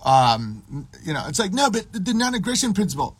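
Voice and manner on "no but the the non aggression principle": high, hurried mock whine; mocked libertarian protest. Libertarian clinging to his principle, mocked high protest. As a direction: bleat the objection fast in a put-on high voice.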